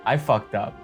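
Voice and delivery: usual speaking voice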